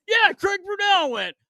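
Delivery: high, loud, excited retold yell; excited reply. Teammate answering the storyteller, high excited shout. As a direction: blurt it out like big news.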